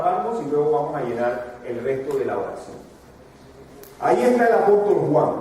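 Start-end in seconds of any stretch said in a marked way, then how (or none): 4.0-5.3 louder and higher, preaching with force; emphatic proclamation. Acted. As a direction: announce it like a revelation. Preacher proclaims dramatic scene.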